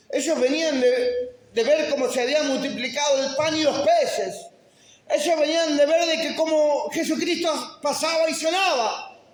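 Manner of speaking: high, loud preaching building to a shout; fervent preaching. Acted. Pastor reminding believers of the miracles, fervent preaching. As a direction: preach with mounting fervor.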